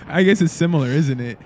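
normal talking voice

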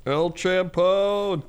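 slow, high drawn-out El Champone; mock gruff boss. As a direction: mimic the cracking boss line. Echoing the dream line.

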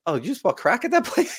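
high, disbelieving question with a laugh; finding out the friend just bought crack, incredulous. Retold or imitated. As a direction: ask it amazed, way up high. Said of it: "I'm like".

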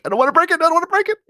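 high, squeaky-pitched mock fretting; mock panic. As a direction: fret in a hammed-up high voice. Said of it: "you're like".

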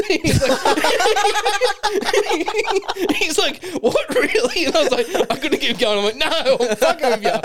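high, loud, words broken by laughter; can barely get the words out for laughing; telling the prank on the new guy, laughing hard; laughing through it. Real, not acted. Cracking up while telling prank.

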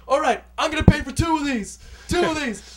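high, loud pitchman call; backtracking hype shout. Retold or imitated. Tyrese backtracking on the price, loud pitchman shouting. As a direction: bark it like a market seller. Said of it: "he's like".

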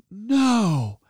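a fan begging not to hear it; anguished protest. Retold or imitated. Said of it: "And I'm like".

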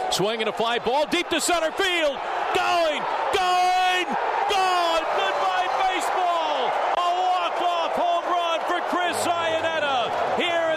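rising shouted home run call. Real, not acted. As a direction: let it build to a roaring goodbye.

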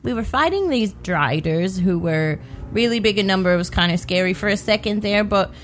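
soft, unhurried recap over music; recap narration. Acted. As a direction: narrate last episode gently.

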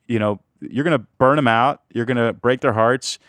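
ordinary talk

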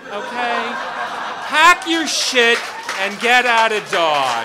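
very loud, slow bellow; furious firing. Retold or imitated. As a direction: roar him off the job. Fires Jim in acted confrontation.

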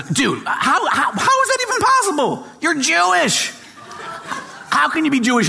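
a DJ who can't believe his ears, voice cracking up high; incredulous host. Retold or imitated.

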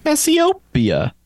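"Cassiopeia" slow and high, stretched on the "o"; drawn-out pronunciation. Shown as a demonstration. Playing with saying the name.

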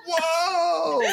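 high, startled whoa; surprised whoa. Retold or imitated. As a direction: gasp it out in shock. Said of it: "we're like".

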